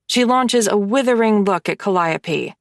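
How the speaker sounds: ordinary delivery, nothing marked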